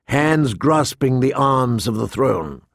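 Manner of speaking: ordinary delivery, nothing marked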